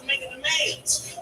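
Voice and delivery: ordinary talk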